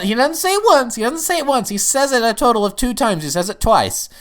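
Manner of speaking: high, loud excited correction; excited emphasis. Real, not acted. Reviewer adding the best part he left out, excited. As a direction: hammer home the count, high and insistent.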